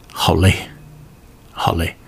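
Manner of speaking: breathy, exhausted sigh of words; 假装疲惫地轻声叹; mock weariness. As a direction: let it fade out like you're worn out.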